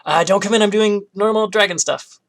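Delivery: high and loud, caught off guard; dragon voice. Acted. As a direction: call out flustered to keep them away.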